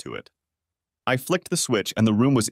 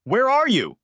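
high, louder angry demand; 13 semitones higher, 4 dB louder than usual. A narrator's reading. Melanie on the phone demanding where Frank is, angry and high. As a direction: snap at him down the phone. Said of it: "Melanie's angry voice".

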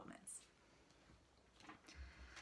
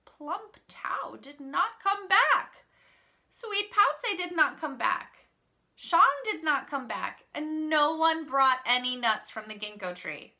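high, loud read-aloud; dramatic story narration; 7 semitones higher, 6 dB louder than usual. A narrator's reading. Teacher reads that no one came back, dramatic and raised. Narrates the wolf's growing wait.

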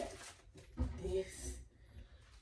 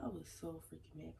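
ordinary delivery

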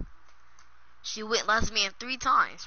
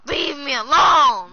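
loud, high, drawn-out shout; exasperated yell; 4 semitones higher, 9 dB louder, slower than usual. Real, not acted. Teased kid telling the teaser off, shouting. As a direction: yell it slowly to fend them off.

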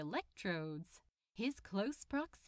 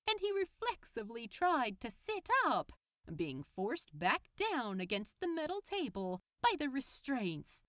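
ordinary delivery

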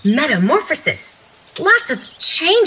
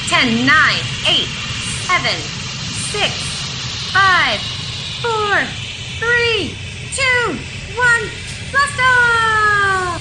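excited countdown; 9 semitones higher, much slower than usual. Pretend. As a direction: build to a high, shouted lift-off. Rocket countdown ending in blast off.

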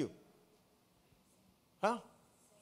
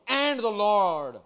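loud, long-drawn proclamation; emphatic verse quotation; 7 dB louder, much slower than usual. Acted. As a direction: proclaim it from the pulpit, stretching each word. Quotes how the verse starts.

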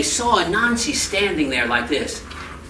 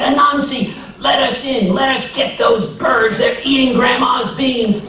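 pleading neighbors; 8 semitones higher, 4 dB louder than usual. Acted. Neighbors pounding on the door.

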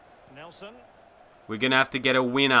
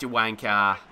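ordinary talk

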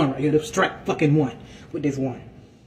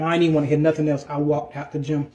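ordinary talk

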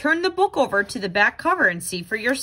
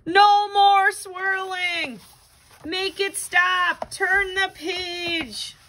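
higher, slow, dizzy begging; dizzy pleading; 7 semitones higher, much slower than usual. Acted. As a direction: plead for the spinning to stop. Book spinning, begging it to stop.

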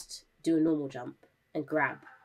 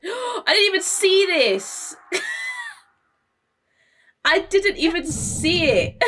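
shocked; 13 semitones higher, 7 dB louder, slower than usual. Real, not acted. Shocked discovery.

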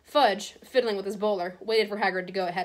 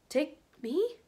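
high, halting question rising at the end; stunned, higher-pitched query; alarmed disbelief; 8 semitones higher than usual. A narrator's reading. Hagrid learns he is being arrested.

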